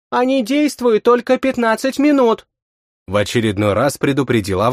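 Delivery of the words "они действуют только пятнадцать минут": put-on high, light girl's voice; young woman voice. A narrator's reading. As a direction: voice her high and bright. Vaska speaks, voiced by narrator.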